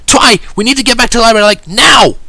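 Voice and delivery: very high, loud, alarmed urgency; high-pitched shouted surprise; surprised Rainbow Dash. A narrator's reading. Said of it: "in a very surprised Rainbow Dash voice".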